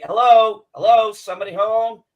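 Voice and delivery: loud calling hello into an open house. Retold or imitated. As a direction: call out to whoever's inside, loud. Calling into neighbor's open door.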